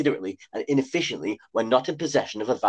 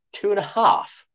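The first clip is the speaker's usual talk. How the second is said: surprised; 9 semitones higher than usual. A narrator's reading. Shay's shocked question.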